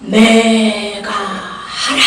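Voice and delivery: very slow, stretched-out divine command; voice of God. Acted. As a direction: intone it solemnly, each syllable drawn long. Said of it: "뭐라고 하시는가?". (길게 끄는 하나님의 음성)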